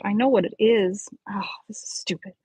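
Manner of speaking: whispered grumble to herself; frustrated whisper. Real, not acted. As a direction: mutter it under the breath, annoyed. Reader frustrated after flubbed retakes.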